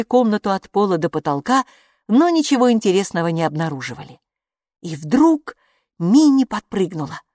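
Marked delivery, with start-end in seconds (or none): none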